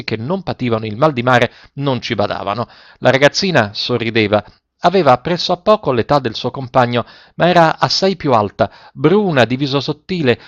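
plain reading voice

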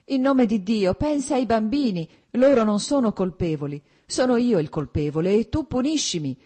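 loud, high-pitched desperate imploring; sobbing plea. A narrator's reading. Husband imploring wife for the children, desperately tearful. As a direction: beg forgiveness with acted tearful urgency. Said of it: "pronunziò ormai singhiozzando".

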